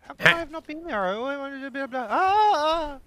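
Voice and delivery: high, loud exasperated yell; frustrated yelling. Real, not acted. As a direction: wail about the missed area.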